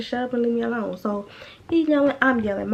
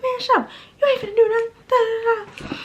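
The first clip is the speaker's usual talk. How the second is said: much higher, louder mock argument; retold yelling; 12 semitones higher, 5 dB louder than usual. Storyteller replaying her trash talk outside, yelling back. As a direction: shout him down, pitched way up.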